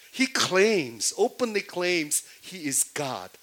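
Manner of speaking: ordinary talk, nothing marked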